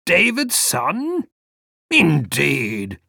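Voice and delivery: put-on dreamy old gentleman searching his memory; vague old man.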